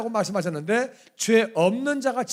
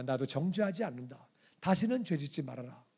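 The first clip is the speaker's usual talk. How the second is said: gentle forgiveness; 3 semitones lower, 6 dB quieter than usual. Retold or imitated. Jesus forgiving the woman.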